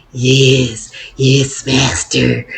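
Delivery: servile hunchback Igor accent, lightly voiced; a hint of Igor's fawning hiss to his master. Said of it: "an Igor, I think, accent".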